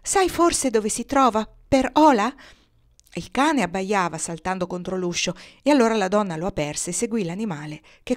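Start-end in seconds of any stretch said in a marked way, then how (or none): 0.0-2.4 loud, high cry in storytelling. A narrator's reading. Mother cries out to the dog about missing Per Ola, crying out. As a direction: call it out urgently, higher and louder. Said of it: "gridò".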